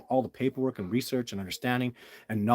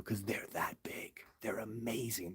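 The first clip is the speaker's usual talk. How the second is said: near-whisper, much softer; hushed awe; 13 dB quieter than usual. Real, not acted. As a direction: drop to an awed hush. Awed by the creatures' size.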